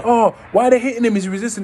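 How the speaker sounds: high, indignant mock outcry; onlookers' protest. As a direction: protest loudly like a bystander. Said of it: "them stories that people go".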